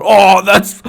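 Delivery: loud, high mock-revolted yell; disgusted outcry. Storyteller reliving the cat mess by his boots, loud disgusted outcry. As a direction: recoil loudly in disgust.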